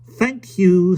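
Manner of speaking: high, loud, brisk and firm. A narrator's reading. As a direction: say it crisply, no nonsense. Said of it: "said Mary Poppins firmly".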